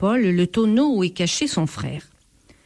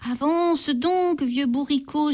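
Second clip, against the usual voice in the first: pleading; 6 semitones higher than usual. A narrator's reading. The thief on the donkey begs it to go faster, desperate. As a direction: beg the old donkey. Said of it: "supplie le voleur".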